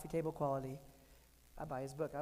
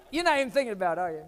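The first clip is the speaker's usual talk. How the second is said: laughing; 2 semitones higher, 9 dB louder, faster than usual. Real, not acted. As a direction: tease the audience while laughing. Laughing at his own ADHD.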